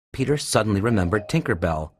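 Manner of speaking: plain reading voice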